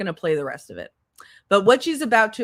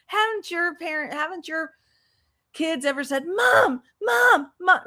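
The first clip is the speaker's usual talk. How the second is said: mocking her plea; 7 semitones higher than usual. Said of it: "she goes on to tell us".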